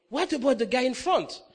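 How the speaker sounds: mock insistent voice from above; retold insistent demand. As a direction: press the question loudly, playfully. God's next demand, retold.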